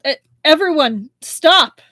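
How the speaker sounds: stammered start, then a high loud shout; officer shouting for everyone to stop. A narrator's reading. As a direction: shout to halt the fight. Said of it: "he shouted".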